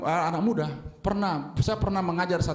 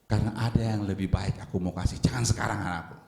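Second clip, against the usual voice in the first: lightly lowered fatherly voice of God; 8 semitones lower than usual. Acted. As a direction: speak as God gently correcting his child. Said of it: "Karena Tuhan berpikir". (suara Tuhan yang sedikit lebih rendah, menasihati anak-Nya)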